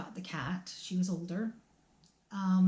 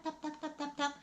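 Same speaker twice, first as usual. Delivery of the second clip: higher, much softer little taps; dainty paw taps; 8 semitones higher, 7 dB quieter than usual. Retold or imitated. Mother imitating the kitten's paws on the stairs, high and soft. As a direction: tiptoe the sounds like a tiny kitten climbing. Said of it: "you'd hear tap".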